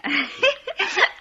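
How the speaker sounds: high, loud cackling laugh; drunken laugh. Acted. Drunk hostess laughing loudly as she serves whisky. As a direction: let out a tipsy high laugh.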